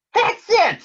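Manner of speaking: high, loud excited bird voice; Big Bird eureka. Acted. Big Bird hits on the puppy's name, high loud excitement. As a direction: shout out the sudden idea.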